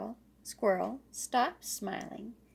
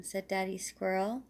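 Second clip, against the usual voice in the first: ordinary delivery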